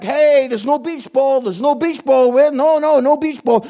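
loud, hammed-up innocent denial; fake-casual "nothing here" protest, hammed up; mock denial. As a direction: play the kid hiding the ball, loudly. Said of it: "you're like".

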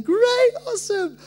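very high, drawn-out, loud cheer; mock elation. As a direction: overdo the thrilled reply. Acting eager reply to God's promise.

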